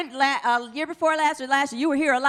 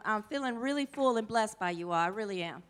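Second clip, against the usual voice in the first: holding back tears; 5 semitones lower, 7 dB quieter than usual. Real, not acted. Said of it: "I'm trying not to cry".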